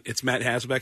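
ordinary talk, nothing marked

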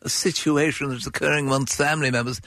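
put-on pompous, over-formal child addressing his father; mock Victorian boy. Boy alerting father to the cold, mock-formal composure. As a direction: drag out the stiff formal report, sinking slightly at the end. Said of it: "Presently the boy said".

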